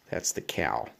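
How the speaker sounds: ordinary delivery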